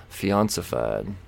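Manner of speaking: ordinary talk, nothing marked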